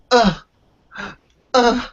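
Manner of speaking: high, loud mock grunts of effort; imitated straining grunt. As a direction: grunt like someone hauling a heavy buggy up stairs. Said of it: "she was going".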